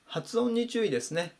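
ordinary talk

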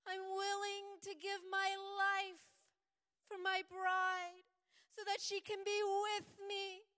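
high, loud, slow and tearful plea; Jesus pleading with the Father for his bride. Acted. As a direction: beg the Father with breaking voice. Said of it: "He's pleading".

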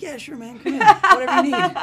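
high, obliging mock welcome; imitating niceness. Co-star welcoming him into the trailer, imitated as nice. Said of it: "he was so nice".

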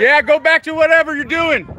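scornful call-out. Real, not acted. Calling after the person insulted.

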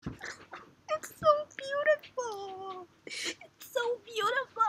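high, soft and very slow; soft swoon. Real, not acted. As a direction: breathe it out, completely melted. Fan melting, soft and slow.

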